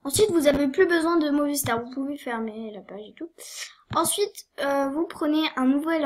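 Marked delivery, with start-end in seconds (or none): 1.8-3.3 sudden whisper, much softer; a quick whispered afterthought; whispered aside. Real, not acted. Quiet aside while explaining.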